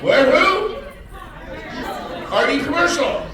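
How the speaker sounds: high, loud shouted question; calling out. Real, not acted. Speaker calling across the room to an agent, loud shout.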